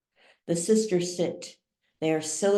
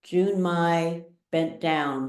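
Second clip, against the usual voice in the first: ordinary delivery, nothing marked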